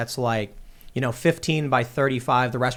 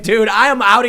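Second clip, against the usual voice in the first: exasperated; 6 semitones higher, 7 dB louder than usual. Retold or imitated. Storming out of restaurant.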